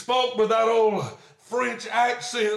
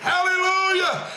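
shouted hallelujah; 7 semitones higher than usual. Real, not acted. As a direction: cry it out in praise. Preacher's exclamation at the climax.

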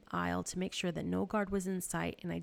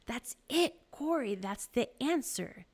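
higher, louder, delighted realization; excited discovery; 6 semitones higher, 5 dB louder, slower than usual. A narrator's reading. Betsy tells Corrie she found it, excited. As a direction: burst out with the answer.

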